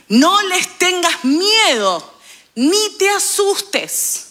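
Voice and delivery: drawn out slowly, pitched high; a reassuring divine command, slowed right down; slow solemn command. Acted. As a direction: stretch each word like a solemn decree. Quoting God's command to the prophet.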